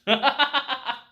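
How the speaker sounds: quick, high burst of laughter; real amused cackle; short cackle. Real, not acted. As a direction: laugh it off briefly. Laughing after quote.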